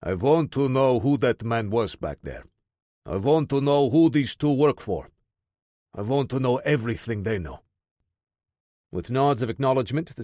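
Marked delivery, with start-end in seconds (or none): 0.0-7.6 put-on commanding orders in a thick Russian accent; a big Russian officer giving orders, Russian accent; heavy Russian accent. A narrator's reading. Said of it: "in a heavy Russian accent".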